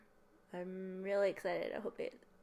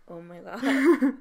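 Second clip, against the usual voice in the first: shocked; 3 semitones higher, 13 dB louder, slower than usual. Retold or imitated. Retold shocked reaction.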